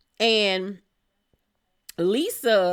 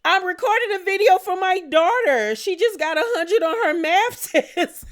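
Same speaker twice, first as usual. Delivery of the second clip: mock Brittany; 9 semitones higher, 6 dB louder than usual. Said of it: "And Brittany say".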